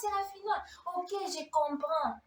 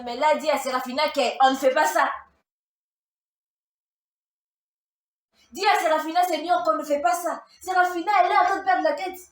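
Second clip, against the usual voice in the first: calling out loudly to the Lord, higher and louder; loud pleading prayer; 5 semitones higher, 11 dB louder than usual. Retold or imitated. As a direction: cry it out to God.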